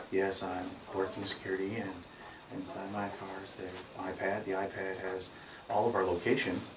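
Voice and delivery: quiet, polite explanation; soft-spoken Marine. Retold or imitated. Guard softly explains the iPad to the police, quiet. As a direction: keep it soft and respectful. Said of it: "He talks like this".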